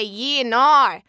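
loud, broad Aussie "yeah, no"; Australian accent. Shown as a demonstration. Actor repeating her friend's request, exaggerated Australian accent. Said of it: "she asked me to say".

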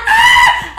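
very high-pitched scream; loud playful shriek. Retold or imitated. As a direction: let out a piercing mock scream. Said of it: "I scream loud".

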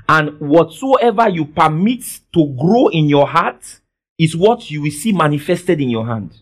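ordinary talk

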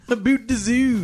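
A boot the zoo in a hammed-up Canadian accent; mock Canadian accent. Teasing friend's Canadian about, hammed-up Canadian accent.

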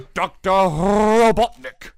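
slow, high, loud cartoon villain; Doctor Robotnik voice. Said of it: "my Eggman action figure".

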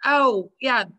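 usual speaking voice

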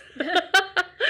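loud laugh. Real, not acted.

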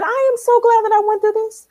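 high, fervent testimony; emphatic. Real, not acted. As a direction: lift it up with conviction.

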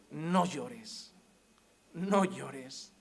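low, soft and gentle; tender comfort. Acted. Jesus comforting the weeping widow, soft and low. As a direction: hush her tears like a kind healer.

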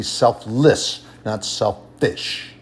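"selfless" and "selfish" split, endings stressed; slow "selfish", leaning on its last syllable; contrastive stress. Real, not acted. Preacher corrects the congregation, stressing the endings of "selfless" and "selfish".

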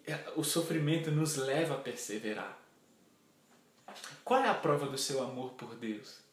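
ordinary talk

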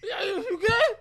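put-on high, sloppy drunken yelling with laughs; slurred drunk caller. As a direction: slur it excitedly down the phone. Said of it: "sauced, and I'm banging his phone".

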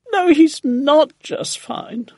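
high, loud, drawn-out protest; indignant cry. A narrator's reading. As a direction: cry out in objection, pitched right up. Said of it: "Missus Silver cried".

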